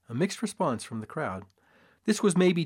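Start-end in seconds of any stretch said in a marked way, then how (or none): none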